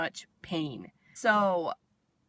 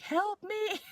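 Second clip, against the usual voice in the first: retold yelling. Patient retelling her panic in recovery, mock yell. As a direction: mimic a panicked plea at held-back volume. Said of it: "I just started yelling".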